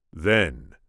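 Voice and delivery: plain reading voice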